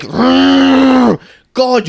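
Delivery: ordinary talk, nothing marked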